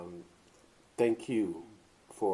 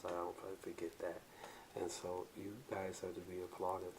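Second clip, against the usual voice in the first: low, soft, smooth talk; lightly done bedroom voice; sexy voice; 5 semitones lower, 8 dB quieter than usual. Acted. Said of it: "Was that your sexy voice?".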